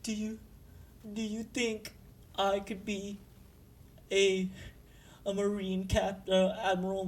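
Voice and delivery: high, soft, halting timid voice; timid trembling. Acted. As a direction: ask it haltingly, small and nervous. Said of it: "kinda trembling".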